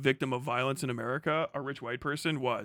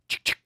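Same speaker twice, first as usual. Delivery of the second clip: two crisp mouth clicks for a pump; imitated shotgun pump; 5 dB louder than usual. First time a Euro hears pumpers, imitated pump clicks. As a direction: click it like a shotgun being racked.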